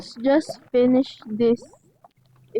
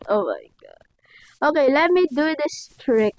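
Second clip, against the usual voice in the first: usual speaking voice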